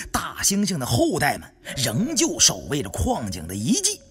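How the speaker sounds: ordinary delivery, nothing marked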